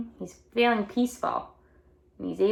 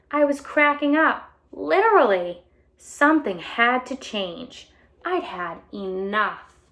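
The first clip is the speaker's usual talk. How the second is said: bright storybook read-aloud voice; animated picture book reading; reading to children; 3 semitones higher, 4 dB louder than usual. A narrator's reading. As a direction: read it out to a class of kids.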